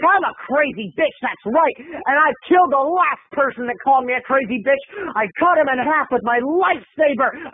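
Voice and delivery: high-pitched unhinged boasting; crazy duck lady. Retold or imitated. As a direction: threaten like a cartoon madwoman. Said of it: "talking with all of her different personalities".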